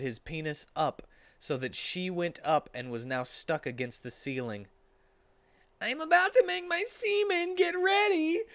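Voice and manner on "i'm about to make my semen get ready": put-on high boyish voice, slightly louder; loud Tails. Tails warning Cream over his tails' noise. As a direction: call it over the noise, squeaky. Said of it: "said Tails loud".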